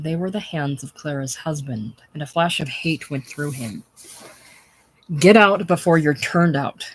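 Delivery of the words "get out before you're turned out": louder sharp order; an employer ordering the smith out; snapped. A narrator's reading. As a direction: bark the order. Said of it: "snapped Thomas Jordan".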